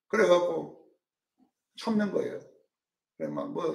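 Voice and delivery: normal talking voice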